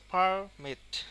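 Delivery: Permit with পার loud and drawn out; stress on first syllable. Shown as a demonstration. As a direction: hit পার hard in permit, stretching it. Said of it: "ফার এর ওপরে অনেকটা pressure stress". (পারমিট-এ পার টেনে জোরে)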